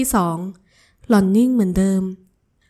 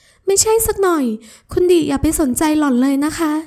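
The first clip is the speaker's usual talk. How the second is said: put-on pleading young woman; 7 semitones higher than usual. Ramon begs her lover to stop bidding, high pleading.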